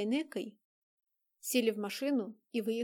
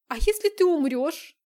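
higher, louder worried question; anxious; 5 semitones higher, 9 dB louder than usual. Acted. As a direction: blurt out the fear. Nastya fearful about losing the house.

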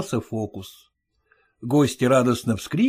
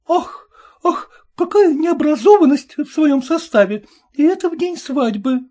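put-on very high, shocked old women's wail; aunts cry out; 14 semitones higher, 4 dB louder than usual. Bride's aunts crying out in shock at the groom. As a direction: gasp in pious horror, high-pitched.

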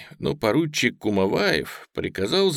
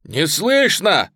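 raised, slow distant call; shouting; 6 semitones higher, 6 dB louder, much slower than usual. Acted. Attacker calls back to the hostage, shouting. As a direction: holler it across the yard. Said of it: "крикнул Бутуйсов".

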